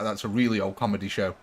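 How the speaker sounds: ordinary delivery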